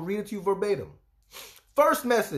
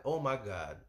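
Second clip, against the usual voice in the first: soft, lower stunned gasp; 9 semitones lower, 7 dB quieter, slower than usual. Retold or imitated. Realizing the man is her husband, soft stunned. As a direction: breathe it out stunned. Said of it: "I'm like".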